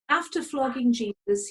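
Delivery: normal talking voice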